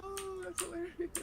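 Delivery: high, breathless laughing words; laughing through pain. Real, not acted. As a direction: laugh through it even though it hurts. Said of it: "Yo, it hurts to laugh".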